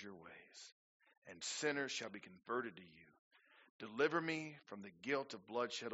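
scripture reading. Acted. Reads psalm slowly, softly.

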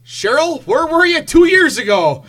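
host thrilled to learn the trick; excited. Real, not acted. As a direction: call out in delight. Excited discovery of feature.

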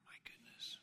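breathless whisper of awe; awed whisper. Real, not acted. Preacher marveling at the memory, whispered. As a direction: whisper it in wonder.

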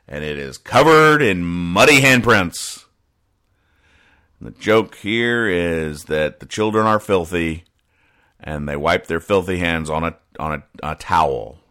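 ordinary delivery

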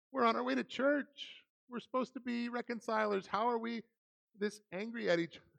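lightly mocking his own churchy thought; mock self-righteous inner voice. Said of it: "part of me was like".

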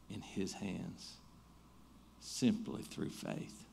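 soft, slow, sincere appeal; earnest appeal. Acted. Pastor closing the sermon, softly and slowly urging faith.

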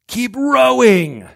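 loud, high, drawn-out call; shouted urging. Acted. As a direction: bellow it slowly, stretching the words. Acted shout to rowers in storm.

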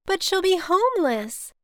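high, loud, alarmed objection; alarmed protest. A narrator's reading. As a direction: protest in rising dismay. Daughter protests the eviction.

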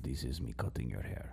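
low, soft mock Khabib accent, ASMR style. Friend joining the pretend Khabib ASMR video, low soft accent. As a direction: murmur it close as a Khabib ASMR barber. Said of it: "Khabib A S M R video".

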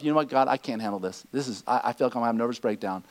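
soft, low, weary confession; broken-down prayer. Pretend. A worn-out believer admitting to God they can't cope, soft and low. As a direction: pray quietly at the end of your strength. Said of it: "when you come to the end of yourself".

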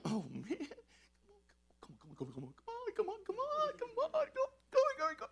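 put-on like a kid who can't wait another second; impatient excitement. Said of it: "chomping at the bit".

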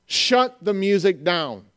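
slow, deliberate order; firm command. Acted. Jesus repeating his order to halt worship, slow and firm. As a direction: space out every word with authority.

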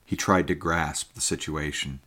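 ordinary delivery, nothing marked

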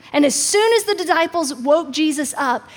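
ordinary talk, nothing marked

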